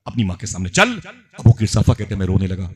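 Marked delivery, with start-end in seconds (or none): 0.1-1.0 raised-pitch scolding from a furious aunt; acted angry tirade, pitched up on the threats. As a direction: lay down the law to the nephew. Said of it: "खाला मेरी बड़ी गजब में थी".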